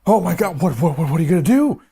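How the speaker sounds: high, loud mock panic; panicked. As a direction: squeal it like you're freaking out. Panicked retelling of makeup disaster.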